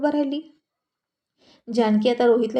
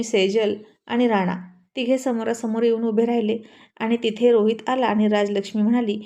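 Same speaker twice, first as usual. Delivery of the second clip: usual speaking voice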